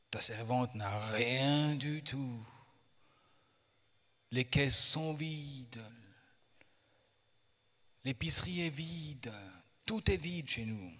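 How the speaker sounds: slow, drawn-out lament; understated plaintive reading; destitute widow. A narrator's reading. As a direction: stretch out each phrase to dwell on the emptiness.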